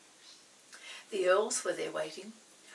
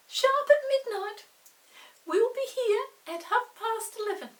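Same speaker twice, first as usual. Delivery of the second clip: high, quick little earl voice; 13 semitones higher, 8 dB louder than usual. Acted. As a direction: pipe up like a tiny earl asking a favour. Earls answer Chinky.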